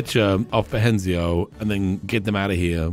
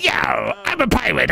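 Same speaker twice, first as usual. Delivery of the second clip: hammed-up pirate voice, higher pitched; funny pirate accent; 9 semitones higher than usual. Comedian retelling an old joke, funny pirate accent. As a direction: put on a silly pirate accent. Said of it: "I'm saying it with a funny accent".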